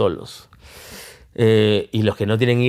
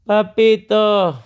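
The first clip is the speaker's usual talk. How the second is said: put-on very slow, high, long-drawn child's wail; plaintive drawn-out papito; child calling papito; 9 semitones higher, 4 dB louder, much slower than usual. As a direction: stretch it out like a small boy calling from bed. Son calling him at night.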